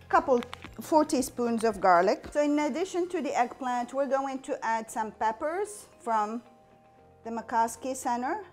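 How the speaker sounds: ordinary talk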